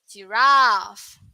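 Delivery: "giraffe" with a long "raff"; British pronunciation. Shown as a demonstration. Teacher contrasting UK "giraffe" with the US one. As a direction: UK "giraffe", held open a. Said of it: "If U K".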